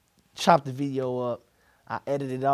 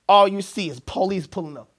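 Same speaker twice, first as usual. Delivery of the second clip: ordinary delivery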